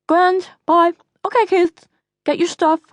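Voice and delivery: high, thick-tongued mother; swollen tongue. Acted. Mother seeing Ryan off and rounding up kids, swollen-tongued. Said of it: "your tongue is swollen".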